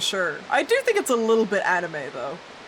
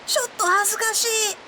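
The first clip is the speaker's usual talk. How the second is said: high, put-on flustered Japanese exclamation; embarrassed anime character; 12 semitones higher than usual. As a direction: squeak it like a bashful anime boy. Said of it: "That's what he would say".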